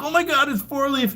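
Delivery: high squeal of sudden recognition; excited recognition. Retold or imitated. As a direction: shriek it like you just met your hero. Fan recognizes him, jumps up hugging.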